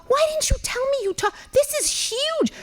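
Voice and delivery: high, slowed amazement; astonished. Retold or imitated. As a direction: stretch out the amazement. Astonished at [name] news.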